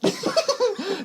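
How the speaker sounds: a sudden high laugh breaking out; high burst of laughter. Real, not acted. Host cracking up at cohost's joke, high laugh. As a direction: laugh up high and let it fall.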